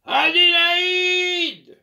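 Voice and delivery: very loud, high, drawn-out bellow; thunderous voice. Acted. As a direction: roar her name like thunder. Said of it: "d'une voix de tonnerre".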